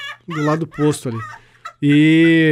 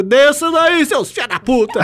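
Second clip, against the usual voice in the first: angry shout; 8 semitones higher, slower than usual. Retold or imitated. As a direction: bark at the kids to get down. (grito bravo, bem mais agudo)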